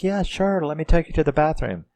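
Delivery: high, fast and sugary; fake-sweet woman. Mother hurrying the girl off to the bathroom, fake-sweet and fast. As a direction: brush her off with fake helpfulness. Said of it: "this woman's like".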